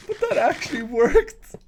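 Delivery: words breaking up with laughter; laughing. Real, not acted. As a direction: laugh through it. Laughing at the door prank.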